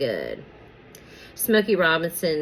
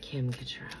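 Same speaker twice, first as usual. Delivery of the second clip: whispered incantation; 2 semitones lower, 15 dB quieter than usual. Real, not acted. Reader whispers the celebrity's name over the tarot deck. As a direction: whisper the name, much softer.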